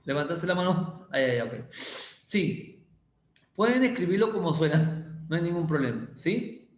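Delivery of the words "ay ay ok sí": ordinary delivery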